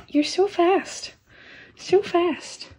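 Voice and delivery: cooing to her fast kittens. Real, not acted. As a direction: croon at the kittens. Baby talk to kittens.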